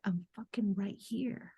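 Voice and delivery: slow, soft, firm reply from within. Retold or imitated. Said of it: "my higher self was like".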